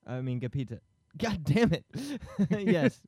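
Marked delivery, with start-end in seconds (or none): 1.2-3.0 laughing. Real, not acted. Laughing at fumbling a name.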